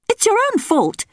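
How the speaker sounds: put-on high matter-of-fact young princess; brisk, unbothered princess; princess Belinda. A narrator's reading. Princess scolding her father, high and matter-of-fact. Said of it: "she said".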